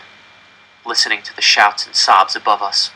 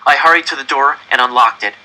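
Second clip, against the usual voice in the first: ordinary delivery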